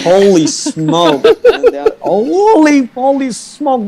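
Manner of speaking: slow, high, stretched-out Holy smoke; drawn-out exclamation. Real, not acted. Guest panics about messages from home, drawn-out high exclamation. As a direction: draw out each word in laughing panic.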